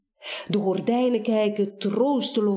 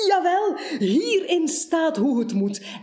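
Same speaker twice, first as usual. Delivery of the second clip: bubbling over with pleasure; delighted; 7 semitones higher than usual. Acted. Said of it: "schilt het uit van plezier".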